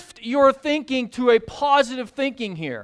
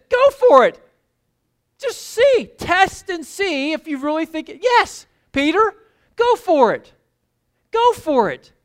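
much higher and louder, eager cheering; excited urging; 8 semitones higher, 7 dB louder than usual. Acted. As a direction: egg him over the side of the boat. Jesus urging Peter onto water.

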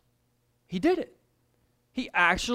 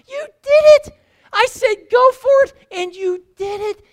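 much higher and louder, near shout; thrilled celebration; 9 dB louder than usual. Acted. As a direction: burst with pride at him. Jesus celebrating Peter.

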